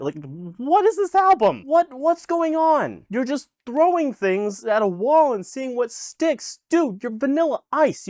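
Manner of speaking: high-pitched, exasperated disbelief; incredulous rant. Real, not acted. Reviewer ranting at the rapper over a baffling album, incredulous. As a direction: pitch way up, demanding answers from the artist.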